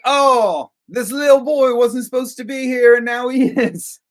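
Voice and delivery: high, loud put-on Australian voice; mock Australian accent. Co-host mocking the film's Mia, Australian accent. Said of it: "I can't keep an Australian accent".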